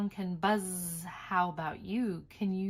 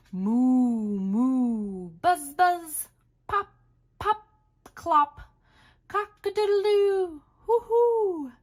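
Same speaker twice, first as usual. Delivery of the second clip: high, loud animal noises; animal sounds; 7 semitones higher, 7 dB louder, slower than usual. Acted. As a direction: run through the animal sounds. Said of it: "Turn the page and let's review".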